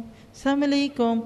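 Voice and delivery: السلام علیکم said slowly as "saam alekum", the opening blurred; mumbled "saam alekum". Shown as a demonstration. Teacher imitating the hypocrites' mock greeting, slow and blurred. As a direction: drag it out, smear السلام into "saam". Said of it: "آواز دبا کے".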